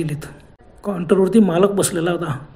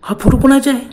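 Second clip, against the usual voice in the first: startled question; 7 semitones higher, 6 dB louder than usual. A narrator's reading. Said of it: "रूपा एकदम दचकली".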